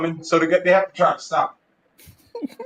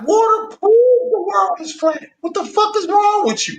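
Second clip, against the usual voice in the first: heated indignation; 12 semitones higher than usual. Acted. Flat-earth believer scolding a doubter, indignant and high. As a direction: argue it incredulously, pitch way up.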